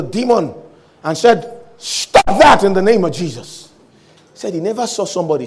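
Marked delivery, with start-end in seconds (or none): 1.8-3.7 loud, drawn-out rebuke; desperate command. Acted. Man rebukes the demon in Jesus' name, desperate and loud. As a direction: order the demon to stop, desperately. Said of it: "Finally, in desperation".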